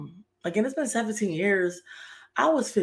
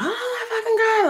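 imitating mom's dramatic shriek, much higher, laughing; 4 dB louder than usual. Mother hearing of Rashad's death, hammed-up high shriek. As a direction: play the overdramatic mother hearing the news. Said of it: "my mother was very dramatic".

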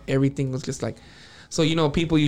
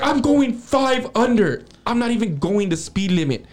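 imitated angry girlfriend; 7 semitones higher than usual. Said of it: "like, the meanest look in her face".

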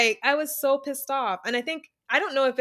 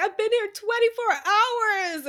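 mock teary whine; 11 semitones higher than usual. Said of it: "She starts tearing up".

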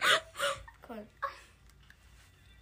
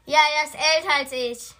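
loud; 2 semitones higher, 11 dB louder than usual. Real, not acted. Answers over loud headphone music.

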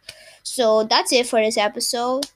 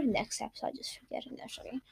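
whisper; 14 dB quieter, faster than usual. Real, not acted. Muttered self-mocking aside.

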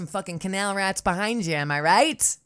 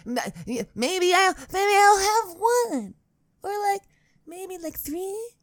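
squeaky hopeful voice; 14 semitones higher, slower than usual. Pretend. Joking hope for more patrons.

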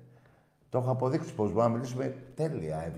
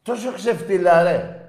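loud, high scornful yell; angry shout; 8 semitones higher, 11 dB louder than usual. Real, not acted. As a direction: blast the insult. Insulting the caller.

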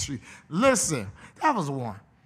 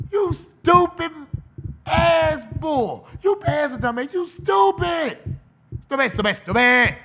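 louder and higher, slow, drawn-out yelling; mock exasperated shout; 7 semitones higher, 4 dB louder, slower than usual. Scolding the player for texting the girl, loud and high. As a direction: yell it like you can't believe how dumb he was.

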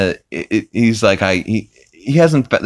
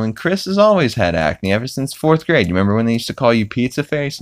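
ordinary talk, nothing marked

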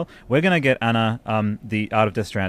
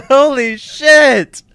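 even higher, laughing shout, slow; laughing yell; 12 dB louder, much slower than usual. Real, not acted. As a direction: shriek it through a laugh of disbelief. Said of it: "sorry for yelling".

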